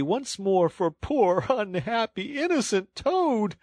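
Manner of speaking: very high, slow self-pitying wail; milk the pity to the end. A narrator's reading. Toad wails for pity to engine driver, self-pitying. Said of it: "in supplication cried".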